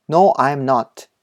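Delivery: slow, carefully spaced model English reading; お手本の英文音読. Shown as a demonstration. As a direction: read each sentence slowly for learners to repeat. (英語のお手本をゆっくり音読)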